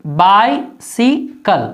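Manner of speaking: "बाइसिकल" stretched out syllable by syllable, bai-si-kal; slow teaching read of "बाइसिकल"; very slow demo. Shown as a demonstration. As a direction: drag "बाइसिकल" out very slowly for the class. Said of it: "ई पढ़ेंगे".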